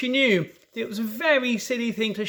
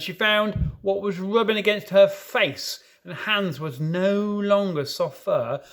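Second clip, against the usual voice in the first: usual speaking voice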